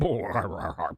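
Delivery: a low suppressed laugh passed off as a throat noise; stifled laugh. Real, not acted. Host reading an owner's statement, stifled laugh. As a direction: choke back a laugh at the quote. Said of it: "something in my throat there".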